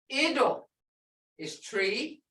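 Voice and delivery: ordinary delivery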